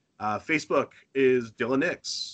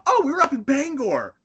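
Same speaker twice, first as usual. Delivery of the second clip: proud tourist; 12 semitones higher, 4 dB louder than usual. Retold or imitated. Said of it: "And they're like".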